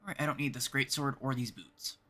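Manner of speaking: ordinary talk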